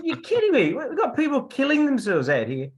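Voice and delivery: high-pitched, exasperated retold yell; acted-out outraged squawk, way up in pitch; incredulous outburst. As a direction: exclaim in loud, high disbelief.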